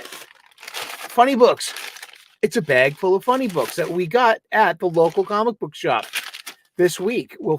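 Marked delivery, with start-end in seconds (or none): 0.0-1.7 slow, high drawn-out announcement; excited announcement. Pretend. Comics host announcing the funny books, slow and excited. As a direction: savor the words like a host.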